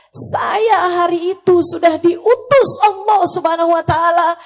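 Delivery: loud outcry climbing high; anguished condemnation. Retold or imitated. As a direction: thunder the sentence through tears. Acted Prophet's anguished condemnation. (kecaman lantang penuh kepedihan)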